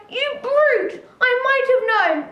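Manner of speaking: high indignant pig voice; a pig outraged at the wolf; indignant cry. A narrator's reading. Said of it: "Pig cried".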